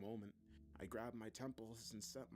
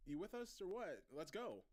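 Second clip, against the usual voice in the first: doctor's raised-pitch, urgent prod; impatient urging; 9 semitones higher than usual. A narrator's reading. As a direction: chivvy a distracted colleague along. Doctor prods distracted Rachel.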